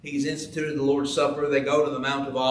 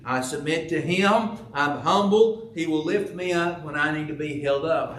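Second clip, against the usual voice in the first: ordinary talk, nothing marked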